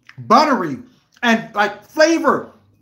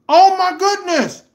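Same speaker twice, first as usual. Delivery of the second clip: normal talking voice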